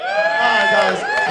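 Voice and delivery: high, loud hype call; hyped host shout. Real, not acted. MC calls out to the crowd, high and loud.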